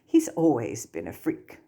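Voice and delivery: looking down her nose, dismissive; snooty. A narrator's reading. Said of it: "in her snootiest tone".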